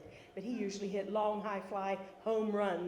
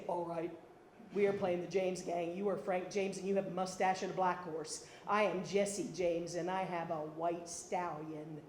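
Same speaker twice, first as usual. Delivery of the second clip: low, measured, imitated sister laying down the rules; deep-voiced older sister; 4 semitones lower than usual. Older sister assigning James Gang roles, low and deliberate. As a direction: take charge in a low voice, slowing toward the end. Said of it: "and Kay would say".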